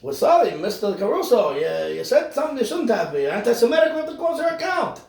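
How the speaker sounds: mock-polite bureaucrat; mock bank official. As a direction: play a smug banker closing the account.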